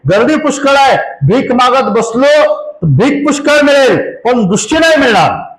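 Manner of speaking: high-pitched, driving sermon delivery; impassioned preaching. Real, not acted. (उंच स्वरात जोशपूर्ण प्रवचन)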